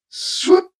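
high, breathy swooping whoosh; whispered whoosh. A narrator's reading. Second dart fired.

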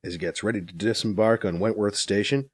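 story narration. Acted. Reads story chapter aloud.

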